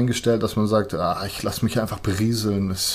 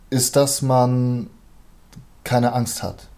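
ordinary talk

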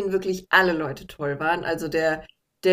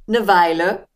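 leicht nachgeahmter Geschäftsmann, etwas lauter; ausweichender Galerist; 6 dB louder than usual. Retold or imitated. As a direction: knapp und geheimnistuerisch abwimmeln. Galerist weicht aus, nachgeahmt. (Galerist weicht der Frage aus, leicht gespielt)

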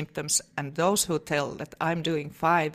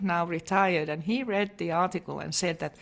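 usual speaking voice

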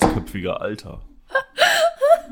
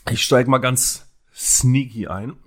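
usual speaking voice

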